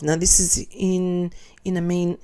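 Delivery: usual speaking voice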